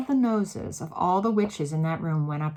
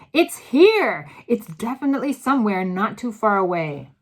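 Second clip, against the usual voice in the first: loud excited; 3 semitones higher, 10 dB louder than usual. A narrator's reading. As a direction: call out the find. Witch sniffing out the smell.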